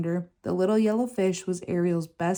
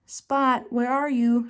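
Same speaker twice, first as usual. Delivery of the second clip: calling out; 5 semitones higher, slower than usual. A narrator's reading. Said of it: "she called".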